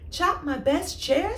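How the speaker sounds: be horrified by the idea; aghast. Acted. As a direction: Sloth horrified at chopping his chair. Sloth aghast at suggestion.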